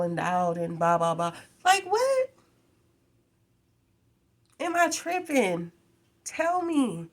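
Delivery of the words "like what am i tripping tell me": high, loud disbelief; can you believe this, pitched up; incredulous. Real, not acted. Asking her viewers in high, loud disbelief.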